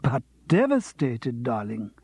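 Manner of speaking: slow, drawn-out devastated; milk the word like a diva; theatrical diva. Acted. Vita to Charles, slow theatrical diva lament.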